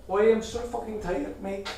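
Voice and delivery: high put-on Irish accent. Shown as a demonstration. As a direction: play up a comic Irish voice. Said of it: "things sounding Irish".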